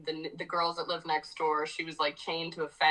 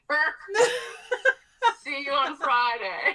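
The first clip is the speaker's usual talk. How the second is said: higher and louder, laughing through the words; laughing cheerful sign-off; 9 semitones higher, 4 dB louder, slower than usual. Retold or imitated. Musician thanking the venue staff, laughing and chirpy. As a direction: chirp it happily while cracking up. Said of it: "I'm like".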